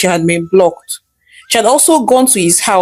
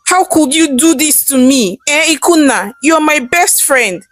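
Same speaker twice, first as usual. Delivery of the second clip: higher, heated confronting of a friend; a mild, higher-pitched angry challenge; angry accusation; 6 semitones higher than usual. Acted. Husband confronts his betraying friend, higher and heated. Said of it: "Emeka said angrily".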